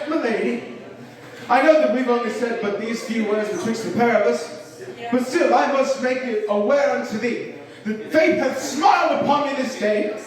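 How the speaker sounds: hammed-up courtly declaration, pitch steadily climbing; Shakespearean prodigy. Boy proposing to a girl like Shakespeare, grandly hammed-up. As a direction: build a mock-Elizabethan proposal to a high finish. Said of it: "I'm not a little Shakespearean prodigy".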